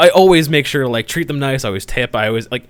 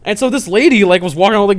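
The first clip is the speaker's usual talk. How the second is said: normal talking voice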